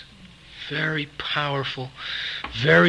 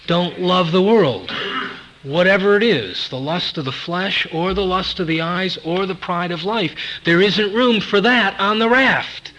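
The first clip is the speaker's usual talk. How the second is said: raised, forceful pulpit exhortation; emphatic preaching; 5 semitones higher, 6 dB louder than usual. Real, not acted. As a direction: preach it as an urgent warning.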